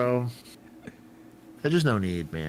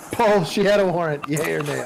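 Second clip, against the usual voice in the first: raised voice across the room; excited call-out; 6 semitones higher, 5 dB louder than usual. Real, not acted. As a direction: call out the news loudly. Calling out to a friend.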